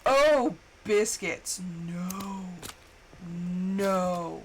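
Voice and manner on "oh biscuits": ordinary talk, nothing marked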